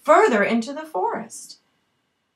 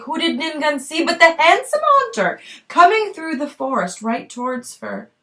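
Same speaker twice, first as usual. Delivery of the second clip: plain reading voice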